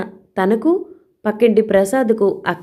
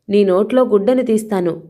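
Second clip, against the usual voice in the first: plain reading voice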